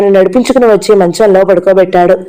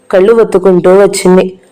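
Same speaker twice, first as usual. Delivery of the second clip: plain reading voice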